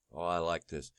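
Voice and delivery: muttered, low and soft "oh, I like this"; sarcastic murmur before the quote; quiet sarcastic aside. Real, not acted. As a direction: drop low and soft, mocking.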